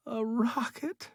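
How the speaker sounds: high, choked cry of disbelief. A narrator's reading. Stranded man seeing his rescue, crying out in disbelief. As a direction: cry it out as if you can't believe your eyes. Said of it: "he cried".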